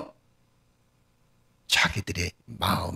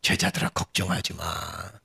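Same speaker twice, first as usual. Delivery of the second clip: lower, slow soothing voice; calm the frightened disciples, unhurried; acted reassurance; 4 semitones lower than usual. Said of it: "이렇게 얘기하지 않았을까요?". (낮고 느리게 달래는 말투)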